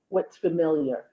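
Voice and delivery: usual speaking voice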